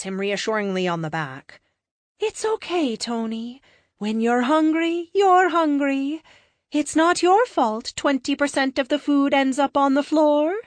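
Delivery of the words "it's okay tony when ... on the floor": high, slow, soothing alien voice; sweet blonde alien consoling a friend; gentle alien comforting. Acted. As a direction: reassure him kindly, a bit otherworldly. Alien Lux comforts Tony.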